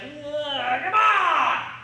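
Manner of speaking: high, loud mock groan of frustration; hammed-up shout of a frustrated shooter. Instructor imitating a student missing the target, mock frustrated shout. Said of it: "you're just like".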